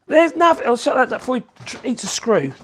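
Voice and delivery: ordinary talk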